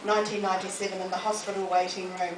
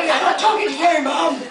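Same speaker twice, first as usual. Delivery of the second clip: put-on higher, louder, laughing teen retort; mocking teenage sons; 7 semitones higher, 10 dB louder than usual. Teenage sons laughing at their blind mum, high and loud. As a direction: laugh through the boys' embarrassed put-down. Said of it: "And they go".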